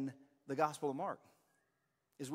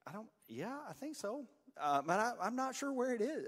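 unsure group member; 9 semitones higher than usual. Retold or imitated. Unsure small-group answer voiced.

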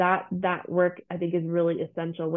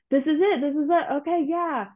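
mock giddy certainty; 8 semitones higher than usual. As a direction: play the all-in believer, overeager. Manifestation people all in.

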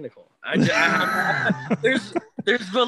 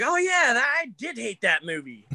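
mock realization; 7 semitones higher than usual. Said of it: "I'm like".

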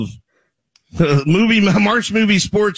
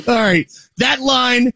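normal talking voice

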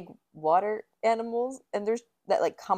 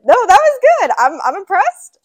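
high, loud, amazed praise; excited praise; 10 semitones higher, 14 dB louder than usual. Real, not acted. Cohost praising the prank, loud and high. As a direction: gush about how good it was.

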